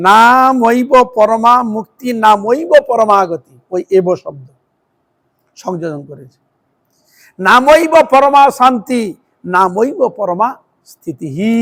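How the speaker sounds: slow measured chant of Sanskrit verse; drawn-out devotional recitation; scripture recitation. Acted. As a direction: intone each line like a sacred text.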